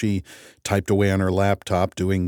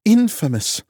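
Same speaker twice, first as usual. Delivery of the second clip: scornful; 13 semitones higher, 4 dB louder than usual. A narrator's reading. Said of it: "Loken scornful".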